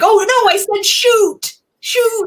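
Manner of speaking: high, hurried oh-no correction; a dad quickly covering his swear; flustered husband imitation. Husband correcting himself in front of his son, flustered. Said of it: "and he's like".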